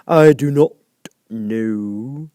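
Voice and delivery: a contrary drawl; caterpillar. A narrator's reading. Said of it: "said the caterpillar".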